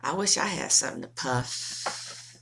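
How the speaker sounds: ordinary talk, nothing marked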